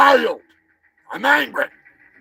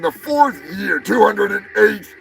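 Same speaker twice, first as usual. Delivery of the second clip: shouting; 6 semitones higher, 3 dB louder, slower than usual. Acted. Host ranting about long show run.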